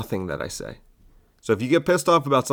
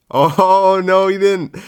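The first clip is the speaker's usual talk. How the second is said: high, loud, laughing gasp; mock shock; 9 semitones higher, 8 dB louder, slower than usual. Comic reacting to his own slur, laughing mock shock.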